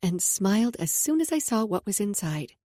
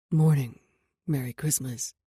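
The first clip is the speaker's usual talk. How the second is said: low rumbling man's voice; deep morning voice; 8 semitones lower than usual. Acted. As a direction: rumble it low, just woken. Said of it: "His deep morning voice rumbled".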